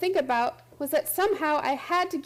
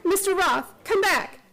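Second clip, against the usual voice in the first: ordinary delivery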